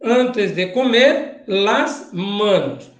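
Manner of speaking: each word spaced out slowly; slow and deliberate. Shown as a demonstration. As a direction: dictate it slowly to the class. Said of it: "preste atenção". (devagar, palavra por palavra)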